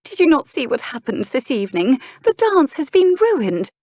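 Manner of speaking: high-pitched dismay, dragging out the complaint; high, loud-edged aristocratic distress; distraught duchess. Acted. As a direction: wail it as an upset, dramatic duchess. Distraught duchess confronts brother.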